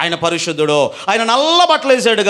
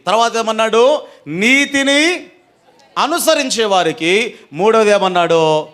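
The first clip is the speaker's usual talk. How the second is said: ordinary delivery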